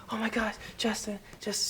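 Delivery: high, excited fangirl squeal; screaming fan. Retold or imitated. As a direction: gush like a starstruck fan. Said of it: "someone who's just like".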